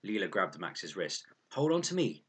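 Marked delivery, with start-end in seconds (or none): 1.5-2.2 higher, louder urgent order; older girl steadying a frightened boy; urgent hold on. A narrator's reading. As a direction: grab him with your voice. Leela grabs Max in danger.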